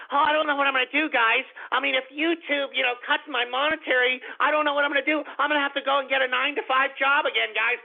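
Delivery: high whiny mock voice; mocking a whiny YouTuber. Host mocking a YouTuber's complaints, high whiny mimicry. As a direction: whine it like a self-pitying crybaby. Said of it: "as much as he's crying about".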